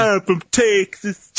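high, slow Southern Cage drawl; Nicolas Cage impression. Joining in on the friend's Cage impression, slow and high. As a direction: stretch the vowels like Cage. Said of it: "that is how Cage sounds in Con Air".

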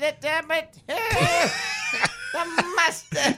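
put-on German-accented grandmother yelling high, breaking into laughter; shouted German accent. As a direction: bark it as a German granny, very high and loud, laughing. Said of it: "I'm making her German now".

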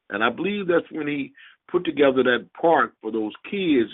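normal talking voice

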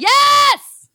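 sudden loud happy yell; excited shout. Real, not acted. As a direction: blurt it out, thrilled. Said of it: "I got too excited".

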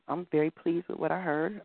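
ordinary talk

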